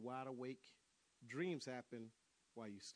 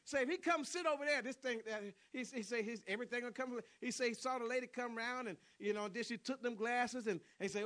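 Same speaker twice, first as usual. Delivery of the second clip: ordinary talk, nothing marked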